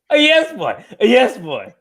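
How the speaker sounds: put-on high catchphrase call dropping on "boy"; playful catchphrase. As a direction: pipe it up high like a mascot cry. Repeating the running catchphrase.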